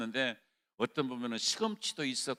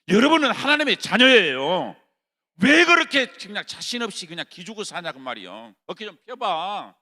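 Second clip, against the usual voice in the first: loud, high sermon cry; fervent shouted preaching; full-force preaching; 4 semitones higher, 13 dB louder than usual. Acted. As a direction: preach it hard to the congregation.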